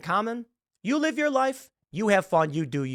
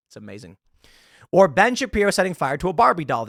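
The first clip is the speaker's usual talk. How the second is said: ordinary delivery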